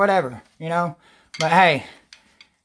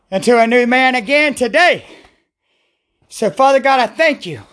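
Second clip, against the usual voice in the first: prayer; 5 semitones higher than usual. Real, not acted. Praying aloud, thanking God.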